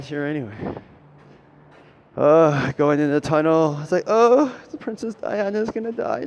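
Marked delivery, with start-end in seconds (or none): 4.1-6.2 mock gasp. Mocking voice of onlookers.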